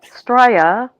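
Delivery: "Australia" as "Straya", rising at the end; Aussie pronunciation. Acted. Co-host practising after the Australian, "Australia" said as "Straya". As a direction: shorten "Australia" to "Straya" with a lift. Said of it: "the pronunciation that we use is".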